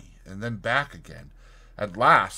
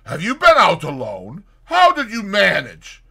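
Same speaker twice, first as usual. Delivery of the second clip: startled; 8 semitones higher, 10 dB louder than usual. Acted. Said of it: "he cried".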